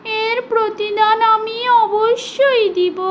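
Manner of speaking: high, slow, tearful squeaky plea; frightened mouse promising to repay the lion; begging mouse. Acted. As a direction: plead for your life as a tiny mouse. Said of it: "কাচুমেচু হয়ে তার প্রাণ ভিক্ষা চাইতে".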